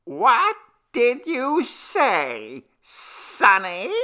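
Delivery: very high, slow granny voice; slow old woman. A narrator's reading. Deaf grandmother asking the men to repeat. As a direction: ask slowly like a hard-of-hearing grandmother. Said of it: "asked the old woman slowly".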